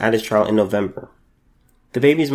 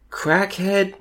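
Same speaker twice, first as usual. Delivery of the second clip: louder, drawn-out words, spitting with disgust; angry outburst; 4 dB louder, slower than usual. Real, not acted. Vlogger condemning the parents who neglected their baby, loud and angry. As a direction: hammer each word at the parents, slow and heated.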